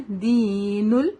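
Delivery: دین with a long held "ee"; slow chant, stretching دین into the laam; ya madd. Shown as a demonstration. Teacher shows the ya madd, long vowel. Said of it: "یہاں پہ یا مد ہے".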